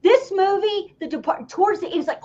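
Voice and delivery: ordinary talk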